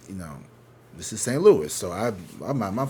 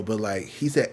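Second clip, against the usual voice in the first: usual speaking voice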